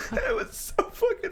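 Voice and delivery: high wheezing laugh through words; laughing hard. Real, not acted. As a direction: laugh while you say it.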